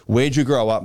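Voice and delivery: ordinary delivery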